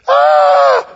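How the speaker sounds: sudden high, loud comic shriek; mock terrified scream. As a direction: scream in exaggerated panic. Elijah's terrified reaction, acted.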